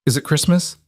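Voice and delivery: put-on small, high child's voice; a drowsy kid asking, hopeful; sleepy little girl. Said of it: "sleep rough and small".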